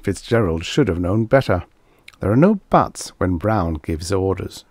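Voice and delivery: ordinary delivery, nothing marked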